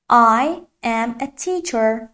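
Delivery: very slow, separated English words; careful teacher's slow demonstration; slow model sentence. Shown as a demonstration. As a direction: model it word by word for learners.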